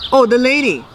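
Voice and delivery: ordinary delivery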